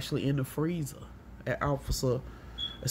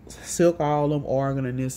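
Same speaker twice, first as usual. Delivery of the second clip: ordinary talk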